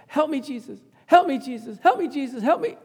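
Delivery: imitated desperate cry, pitched high. Preacher acting his dying dad calling to Jesus, crying out high. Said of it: "Cry that out".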